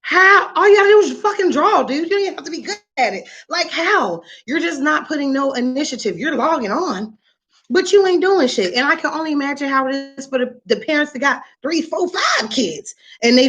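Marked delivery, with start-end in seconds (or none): 0.0-8.7 higher-pitched mock outrage at failing art; scolding parent. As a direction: tear into the kid over the report card. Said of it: "I'm like".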